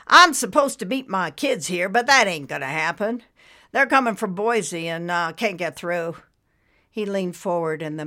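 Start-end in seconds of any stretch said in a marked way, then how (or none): 0.1-6.2 raised, louder weary man's voice; a stranded dad venting to strangers; lightly voiced worn-out father; tired waiting father. Thin balding man waiting.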